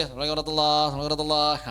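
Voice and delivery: syllables swallowed at speed; very rushed salam. Shown as a demonstration. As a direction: blurt it out far too fast. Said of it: "Aduh, bahaya ini". (salam sangat cepat dan tertelan)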